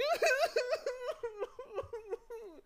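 very high wailing sob; hammed-up high bawling; long, shrieky fake cry; hysterical crying. A narrator's reading. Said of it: "And crying hysterically".

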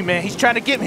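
ordinary delivery

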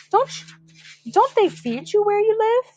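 high, nasty bully voice; sneering. Acted. Bully Spider mocking John, high sneering jibe. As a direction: sneer it down at him. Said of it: "he sneered".

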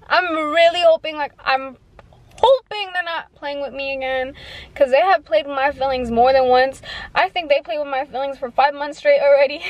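ordinary talk

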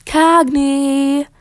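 loud, high, drawn-out holler; distant calling. A narrator's reading. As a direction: call a name across a big garden. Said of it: "came that voice again, from far away".

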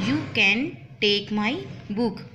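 normal talking voice

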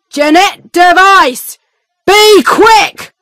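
high, loud, impatient shout; guard calls again. Acted. Guard calls the prisoner again to hurry, impatient shout. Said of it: "the guard calls again".

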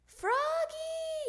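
very high, loud, long-held name; yelling. A narrator's reading. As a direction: yell for a child to stop. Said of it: "yelled Dad".

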